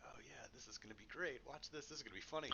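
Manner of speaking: hushed, secretive; whispering. Retold or imitated. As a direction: whisper it conspiratorially. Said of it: "whispering to each other".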